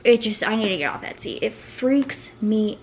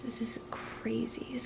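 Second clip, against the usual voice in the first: awed whisper; 17 dB quieter, slower than usual. Real, not acted.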